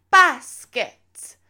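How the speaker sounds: loud, high British cheer calls; British accent. Retold or imitated. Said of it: "I did things in a British accent".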